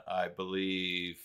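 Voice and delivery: usual speaking voice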